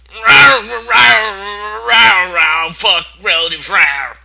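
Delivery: high, loud nonsense wailing; hammed-up grunting brother. Big brother answering with mock wailing grunts. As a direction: howl the rows like a dumb big lug. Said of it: "Big Macintosh was like".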